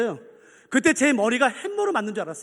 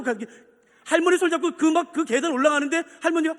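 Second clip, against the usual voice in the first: normal talking voice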